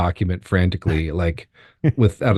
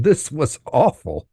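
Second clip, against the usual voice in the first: usual speaking voice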